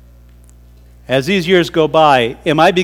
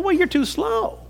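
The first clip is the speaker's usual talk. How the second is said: bird friend; 13 semitones higher, 10 dB quieter than usual. Acted. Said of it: "I said".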